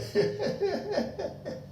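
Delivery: high mocking cackle; mocking laugh. Boss gloating after cheating the employee, high mocking cackle.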